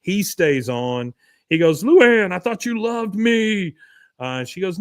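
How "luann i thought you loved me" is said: put-on pleading boyfriend. Retelling Trip pleading with Luann.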